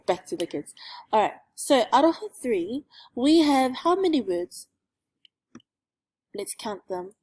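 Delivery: ordinary talk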